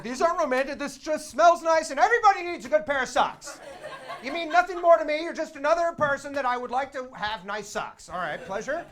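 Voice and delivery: loud, high, insistent disavowal; overdone denial. Retold or imitated. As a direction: protest far too much, at full volume. Overdone denial of romantic gift.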